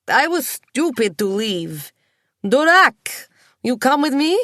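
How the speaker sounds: put-on loud, slow, drunk Russian accent with stretched vowels; drunken Russian accent. A narrator's reading. Drunk Mishka urging Nicola to come to Russia, loud slow Russian accent. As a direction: boom it out like a tipsy Russian, dragging every vowel. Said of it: "stretches and softens vowels, Russian words".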